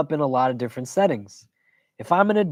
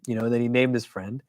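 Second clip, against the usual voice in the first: ordinary talk, nothing marked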